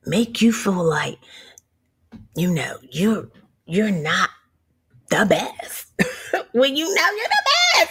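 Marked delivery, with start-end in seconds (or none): none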